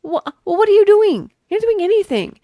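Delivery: loud, high exasperated rant. Real, not acted. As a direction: vent at him loudly. Ranting at the character.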